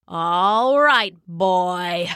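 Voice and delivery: put-on low old man coaxing the dog; a hint of a gruff old hunter; lightly voiced old man; Papa's voice. A narrator's reading. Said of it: "said Papa".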